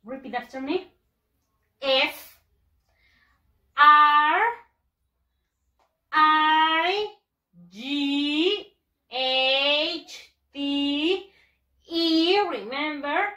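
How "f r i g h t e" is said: very slow letters, each stretched and held high; long-held letters with big pauses; spelling out. Shown as a demonstration. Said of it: "Vamos a deletrearlo".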